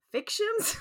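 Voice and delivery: high, disbelieving echo of Fictions?; incredulous echo. Real, not acted. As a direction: repeat the word in mock disbelief. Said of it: "Has this happened in many fictions?".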